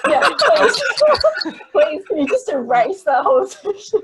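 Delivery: high, loud, laughing through the words; laughing plea. Real, not acted. Guest begging host to erase her accent attempt, laughing. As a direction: plead through helpless laughter.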